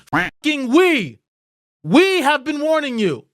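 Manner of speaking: slow, loud, high yell on fucking we; real rage at full volume; furious shout. Real, not acted. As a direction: shout it like a warning ignored. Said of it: "I get so fucking angry".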